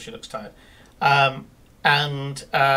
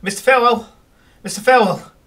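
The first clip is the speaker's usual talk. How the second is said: shouting a name, louder and higher; frantic calling; 6 semitones higher, 8 dB louder, much faster than usual. Acted. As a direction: call urgently through the door. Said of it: "there's a guy at the door".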